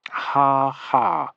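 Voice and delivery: flat ha ha. A narrator's reading. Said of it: "Septimus responded flatly".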